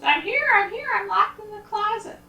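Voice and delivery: very high squeaky falsetto; tiny high voice calling for rescue; trapped grandma calling. Acted. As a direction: cry out to be let out. Grandma knocks and calls from closet.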